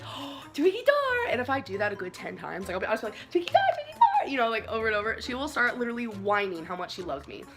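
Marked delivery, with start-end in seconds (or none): 0.0-1.3 put-on much higher, slow cooing baby talk; pet voice. Cooing at dog on lap. 3.3-4.3 very high, louder squeaky calling; pet voice. Retold or imitated. Calling dog name repeatedly.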